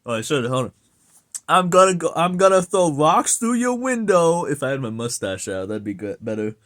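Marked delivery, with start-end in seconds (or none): 1.5-4.5 loud, higher-pitched play threat; mock threat. Streamer clowning about vandalism, mock threat.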